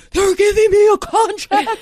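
high, loud freak-out over big news; retold flipping out. Employee running in to the boss about a contract, flipping out. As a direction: shriek it, reenacting a freak-out. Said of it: "flipping out".

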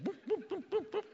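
high, soft little boops; comic hopping noises. Acted. Preacher mimes the bound man hopping from the grave, high comic boops. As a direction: bounce out tiny squeaked hops.

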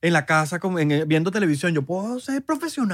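host voices an uncle who wants to go pro at beer drinking; mock uncle. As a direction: brag like a couch drinker turning pro. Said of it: "Imagínate contarle eso a los tíos".

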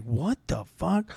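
stunned under the breath; muttered disbelief. Retold or imitated. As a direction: cop learning the truth, soft and slow. Cop's stunned reaction.

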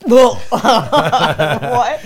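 hearing she'll sing the anthem, laughing disbelief. Retold or imitated. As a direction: laugh it out in shock. Reaction to singing anthem.

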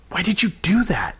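high, whispered reproach; appalled whisper. A narrator's reading. Boy to friend who provoked the teacher, appalled whisper. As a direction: whisper it urgently, pitch right up.

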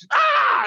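comic retold yelp; imitated pain scream. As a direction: scream like you're grabbed by the neck. Imitates classmate screaming in neck grab.

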